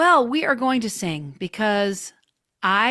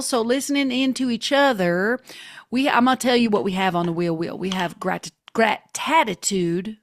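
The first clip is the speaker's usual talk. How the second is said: ordinary talk, nothing marked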